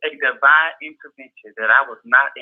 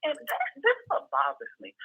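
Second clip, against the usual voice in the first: much higher, indignant outburst; outraged rant; 13 semitones higher than usual. Real, not acted. Host venting about a critic, high outrage. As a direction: throw the question out in disbelief, pitched way up.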